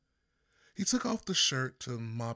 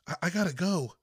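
stammering, louder and higher in a rush; hurried flustered excuse to leave; 6 semitones higher, 7 dB louder, faster than usual. Acted. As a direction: blurt it out, caught off guard. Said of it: "My voice sounded flustered to me".

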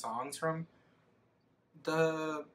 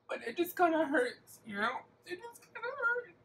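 mock hurt. Hurt by fewer likes.

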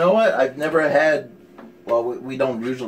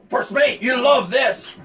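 pirate captain call; 10 semitones higher than usual. Acted. Pirate captain hailing first mate.

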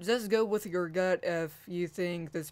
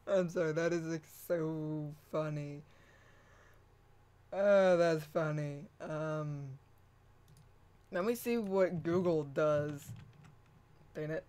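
ordinary talk

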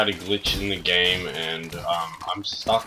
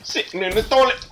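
high, fast mocking echo of "toilet"; mocking singsong; 12 semitones higher, faster than usual. Echoed in singsong.

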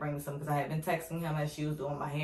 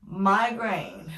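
ordinary talk, nothing marked

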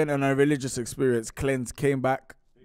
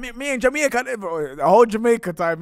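higher-pitched mock Jamaican accent; imitated Jamaican accent; 5 semitones higher, 5 dB louder than usual. Friend mimicking his mate on holiday, put-on Jamaican accent. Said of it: "he's got a Jamaican accent".

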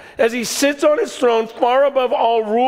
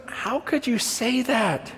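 retold puzzled question; 2 semitones higher, 7 dB quieter than usual. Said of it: "Jesus says the weirdest thing".

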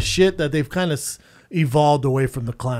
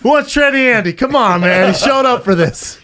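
loud, high call to a crowd; hammed-up rallying for a last pick; mock pleading pitch; 7 semitones higher, 7 dB louder than usual. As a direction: project it like pretend auctioning off a friend. Mock announcer pitching friend to crowd.

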